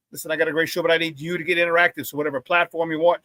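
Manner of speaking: ordinary talk, nothing marked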